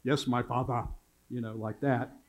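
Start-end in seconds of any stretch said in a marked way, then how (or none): 0.0-0.9 lightly imitated German accent; kids' mock German-accented reply. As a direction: put on a slight German accent. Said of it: "saying it with a German accent".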